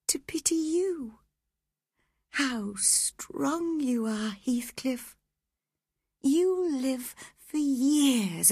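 put-on high, wistful voice of a sick young woman, half whispered; dying Catherine.

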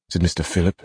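plain reading voice

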